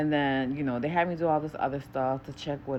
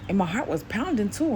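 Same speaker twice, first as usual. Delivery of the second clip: ordinary talk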